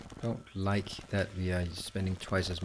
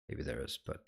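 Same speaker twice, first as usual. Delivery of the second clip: whispered aside; 9 semitones lower, 12 dB quieter than usual. Real, not acted. Muttered aside to himself.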